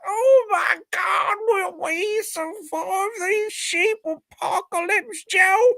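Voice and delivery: very high, shrieking mock terror; mock panic. Pitching the movie to Joe with a shrieking B-movie panic. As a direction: wail it like a B-movie victim.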